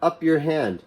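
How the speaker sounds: usual speaking voice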